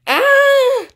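long, high, loud scream; alarmed scream. Real, not acted. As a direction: scream in a game panic. Fleeing rising water in game.

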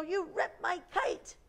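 put-on high-pitched accusing little girl; outraged storybook girl, pitched high; indignant girl's shout. A narrator's reading. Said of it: "shouted the girl".

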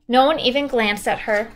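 usual speaking voice

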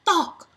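sharp high thunk; thunk sound. Acted. Reader voicing the racket hit, sharp thunk.

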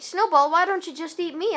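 usual speaking voice

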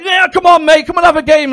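high, loud, chirpy nagging; pushy holiday rep. Retold or imitated. Animator nagging the relaxing dad by the pool. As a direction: pester him into joining in. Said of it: "he's like".

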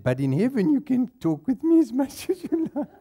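high voice breaking into laughter; laughing joke. Retold or imitated. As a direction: deliver the punchline laughing. Joke told to wife.

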